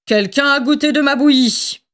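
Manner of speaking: grumbling father bear, put-on voice; storybook papa bear grumbling at his porridge; Papa Bear's big voice. A narrator's reading. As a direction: rumble it like a cross bear dad. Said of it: "gronda Papa Ours de sa grosse voix".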